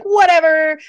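high, loud blow-off; imitating a don't-care outburst; mock dismissive. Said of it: "I don't care".

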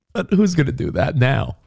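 normal talking voice

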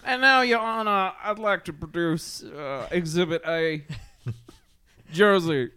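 put-on pompous Kentucky lawyer accent. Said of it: "As I use my Kentucky accent".

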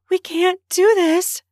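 high, loud, slow protest; breathless protest. A narrator's reading. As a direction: protest while catching your breath. Breathless protest during love scene.

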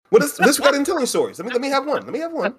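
high-pitched, pleading whine of protest; mock protest. Host begging co-hosts to let him tell one, high mock protest. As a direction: plead high, like being denied a turn.

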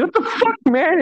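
sudden high-pitched protest; startled outrage. Retold or imitated. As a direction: yelp it like you just got tickled. Tickled during basketball.

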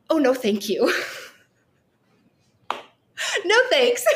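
high, laughing no thanks; laughing refusal. Retold or imitated. Turning down the medium's news with a laughing no thanks. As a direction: laugh it off, half-joking refusal.

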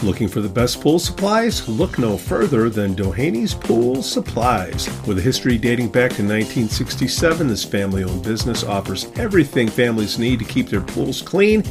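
upbeat sponsor read over music. Acted. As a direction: sell it like a radio spot.

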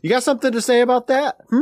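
a high, fast, teasing threat; half-joking interrogation of the cohost; mock menace. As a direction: dare him to say something, playfully.

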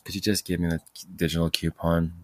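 ordinary talk

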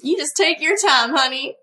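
kind Southern waitress; a touch of Southern honey; light Southern accent. Acted. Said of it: "Judy has this accent".

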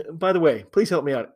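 ordinary delivery

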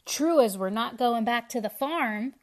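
loud, defiant chorus; loud cry. A narrator's reading. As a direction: shout it as one. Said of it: "cried the cabbages".

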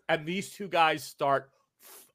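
normal talking voice

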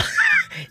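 short high-pitched giggle; high squealing laugh. Real, not acted. Host teases older caller, high squealing giggle. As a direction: burst into a brief squeal of laughter.

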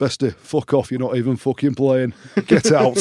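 loud, rising mock yell; retold teammate yelling. Teammate standing up to throw an injured player out, loud. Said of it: "stood up and was like".

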